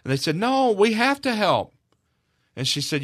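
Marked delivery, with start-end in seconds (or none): 0.3-1.8 put-on kids insisting. Kids insisting, retold by mother.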